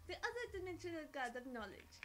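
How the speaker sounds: put-on playful French-accented storyteller; horrible French accent. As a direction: tell it with a hammed-up French accent. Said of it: "Excuse my horrible French accent".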